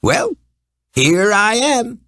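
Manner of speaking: high, slow, cheery voice of a funny little man. A narrator's reading. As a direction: pop up cheerfully, stretching it out. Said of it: "said a cheery voice".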